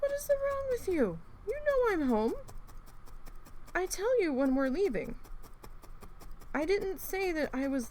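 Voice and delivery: high pet-talk scolding; scolding the dog. Retold or imitated. Talking to the howling dog, high scolding pet voice. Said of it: "I'm like".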